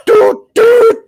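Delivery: high, loud toy-horn "Doot, doot"; playful honking noise imitating the boss; mock alarm toot. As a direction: blurt it out like a silly alarm going off. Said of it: "Big Boss is like".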